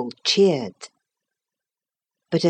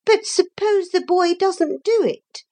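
very high, thin objection; thin, disagreeable voice. A narrator's reading. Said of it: "a thin and disagreeable voice".

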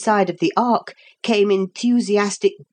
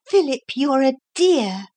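plain reading voice

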